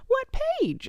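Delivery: high-pitched retold challenge; imitating Cindy Lou. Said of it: "And she's like".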